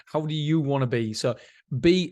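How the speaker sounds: usual speaking voice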